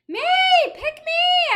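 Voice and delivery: very high, loud pleading shout; eager shout. Acted. Child begging to take the mice home, shouted. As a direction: beg to be chosen. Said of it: "I shouted".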